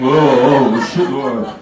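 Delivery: loud, low Bosnian grunts; comic Bosnian talk, strained as if on thin ice; guttural Bosnian groaning. Acted. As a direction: moan it in a mock Bosnian throat voice. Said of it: "sounds like we're walking on a thin surface and ejaculating".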